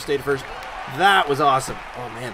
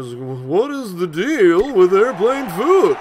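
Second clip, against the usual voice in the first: slow, loud, rounded-out Seinfeld standup voice; hammed-up comedian bit, stretched and loud; exaggerated Seinfeld impression; 4 semitones higher, 8 dB louder, much slower than usual. Shown as a demonstration. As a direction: overdo the classic airplane-food bit. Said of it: "you do the Seinfeld impression".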